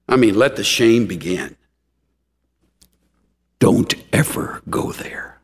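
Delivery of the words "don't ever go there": whispered warning. Real, not acted. Preacher's hushed warning.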